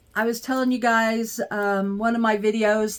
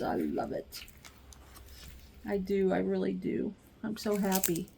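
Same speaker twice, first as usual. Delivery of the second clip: softer, hushed and sincere; tender hush; 11 dB quieter than usual. Real, not acted. Gazing at her new light pad, a soft tender hush. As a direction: breathe it out lovingly.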